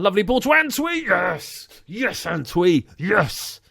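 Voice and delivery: loud, high-pitched cheer; excited goal celebration. Real, not acted. Gamer cheering Antwi's goal, excited celebration. Antwi scores after a lovely ball.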